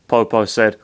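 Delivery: ordinary delivery, nothing marked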